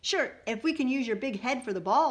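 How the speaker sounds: loud, high retort; shouting back. A narrator's reading. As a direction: shout a comeback at the teasing. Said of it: "I shouted back".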